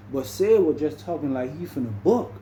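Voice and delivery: ordinary talk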